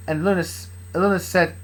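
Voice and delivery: ordinary delivery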